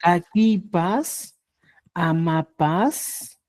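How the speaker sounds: slow demo. Shown as a demonstration. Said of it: "Diría".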